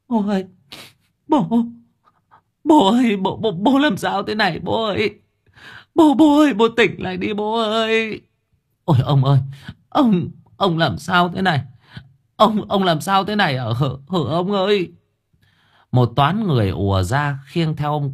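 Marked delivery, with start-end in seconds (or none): 0.1-8.2 high sobbing cries for father; wailing. A narrator's reading. Grown child wails over the unconscious father, sobbing high. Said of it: "tiếng khóc tỉ tê". 8.8-14.9 high, distraught lament; wailing wife. A narrator's reading. Old wife wails at her collapsed husband, distraught.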